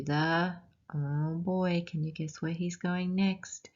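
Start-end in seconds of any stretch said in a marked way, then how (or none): none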